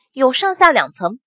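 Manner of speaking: ordinary delivery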